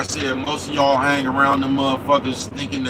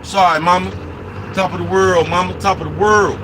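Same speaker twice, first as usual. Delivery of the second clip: calling out loudly and higher; 6 semitones higher, 6 dB louder than usual. Pretend. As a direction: belt it out to mama. Apologizing to mama for the camera.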